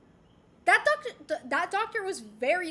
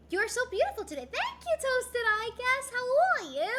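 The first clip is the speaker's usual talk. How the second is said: cutesy chat voice; 10 semitones higher, 4 dB louder than usual. Acted. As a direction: coo the chat message back sweetly. Reading chat messages to viewers.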